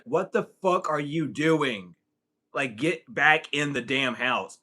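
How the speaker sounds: slow, drawn-out retold telling-off; mock-stern, word by word; exasperated scolding. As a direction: spell it out slowly, as if ordering a wayward friend. Retold scolding of drunk friend.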